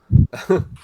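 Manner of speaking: breathy laugh. Real, not acted. Player laughs at failure.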